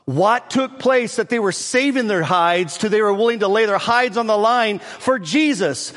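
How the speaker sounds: high, loud revival preaching, driving the story forward; preaching at full force. Acted. Pastor fires up the congregation retelling Acts, full-force preaching.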